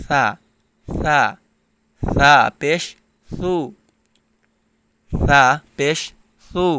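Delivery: সা as a soft lisped th sound; teacher's careful soft th in সা and সু. Shown as a demonstration. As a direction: say সা and সু with a gentle th, drawn out. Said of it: "নরম হবে".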